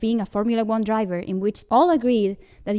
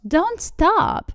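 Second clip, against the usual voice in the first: high-pitched playful protest; 9 semitones higher than usual. A narrator's reading. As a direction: object in a raised, pleading voice. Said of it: "I protest when he stops".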